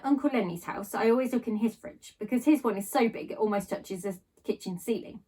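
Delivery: plain reading voice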